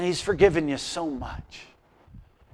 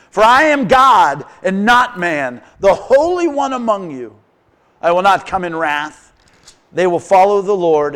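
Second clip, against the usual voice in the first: louder proclaiming of the verse; loud scripture reading; 9 dB louder than usual. Acted. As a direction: declare God's words to the church. Reads scripture aloud loudly.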